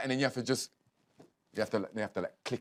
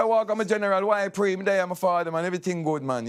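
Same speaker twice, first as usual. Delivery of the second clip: Jamaican patois; 3 semitones higher than usual. Shown as a demonstration. Englishman showing how he'd greet a Jamaican, in patois. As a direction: switch into animated Jamaican patois. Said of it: "but if you're a Jamaican".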